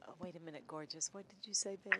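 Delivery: soft, low, hushed imitation of husband. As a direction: murmur it low and sultry. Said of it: "What he says is".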